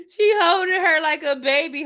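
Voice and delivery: high, breathless laugh into the line. Real, not acted. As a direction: crack up while telling it. Laughing retelling friend held like baby.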